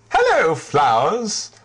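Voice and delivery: bright high-pitched greeting; chirpy young character voice; high cheerful voice. Acted. Richard greets the flowers.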